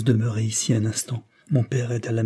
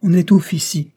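higher, louder exclamation; exclaimed excuse; 8 semitones higher, 7 dB louder than usual. A narrator's reading. Daughter making an excuse to leave the room, exclaiming. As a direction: cry it out as a quick excuse. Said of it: "s'écria-t-elle en manière d'excuse".

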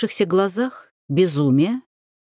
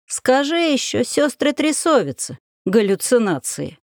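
высокий насмешливый старушечий голос; поддень его, усмехаясь; с усмешкой; 6 semitones higher than usual. Acted. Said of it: "усмехнулась старуха". (насмешливая старуха)

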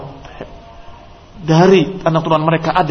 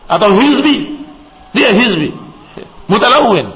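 mocking labels; 7 semitones higher, 4 dB louder than usual. Mocking people branding others.

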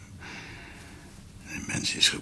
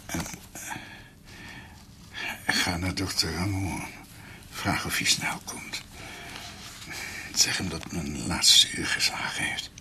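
zwak, fluisterend op het sterfbed; dying whisper; 9 semitones higher, 3 dB quieter than usual. Acted. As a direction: fluister als een stervende man. Dying doctor whispers to Martine. (stervend gefluisterd)

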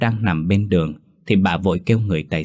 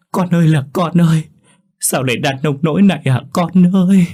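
high, loud anguished wailing; keen it out at the top of the voice; khóc than thảm thiết; 9 semitones higher, 6 dB louder than usual. A narrator's reading. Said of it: "khóc nấc lên thành tiếng". (người mẹ gào khóc thảm thiết, cao và to)

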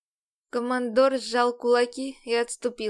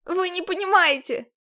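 desperate cry; 7 semitones higher, 5 dB louder than usual. Acted. Heroine desperate outburst.

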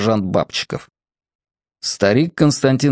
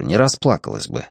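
ordinary delivery

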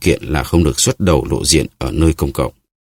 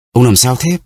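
high alarmed call; Planchet crying out; 7 semitones higher than usual. A narrator's reading. Servant cries out to his master, alarmed. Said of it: "Planchet kêu lên".

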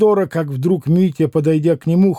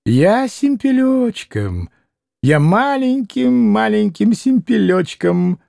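high, slow, simpering voice; blissful babbling; 5 semitones higher, slower than usual. A narrator's reading. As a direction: drag it out in tiny-voiced delight. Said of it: "блаженно бормотал Максимов в страшной радости". (блаженно, тоненьким голоском)

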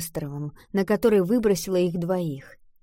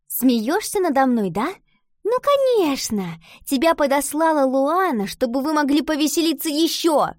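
high-pitched, sarcastic outburst; offended, raised-voice retort; indignant accusation; 6 semitones higher, 4 dB louder than usual. A narrator's reading. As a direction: accuse her hotly, feeling mocked. Iva indignantly accuses Valentina of mocking.

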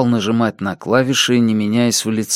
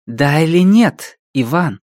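insistent pressing; 6 semitones higher, 3 dB louder than usual. A narrator's reading. Ди presses a reluctant Иван, insistent questioning.

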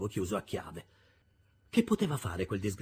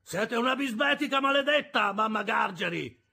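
high-pitched angry snarl; growled insult; 11 semitones higher, 5 dB louder than usual. A narrator's reading. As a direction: snarl it at the boss's wife. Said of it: "ringhiò l'avventizio".

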